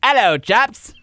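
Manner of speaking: mock British greeting; hammed-up British "chaps"; British accent. Shown as a demonstration. Reading a UK listener's email to co-host, mock British accent. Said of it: "say it in a British accent".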